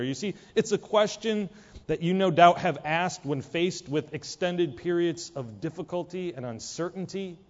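normal talking voice

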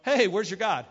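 loud taunting call; jeering. Retold or imitated. Elijah to the prophets of Baal, jeering. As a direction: jeer at a rival crowd. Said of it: "Elijah starts jeering them".

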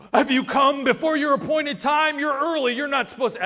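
loud, high, startled demon cry; alarmed demon. Retold or imitated. Demon crying out to Jesus in loud alarm. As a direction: shout it out like a panicked demon. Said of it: "a demon popped out at him one time and was like".

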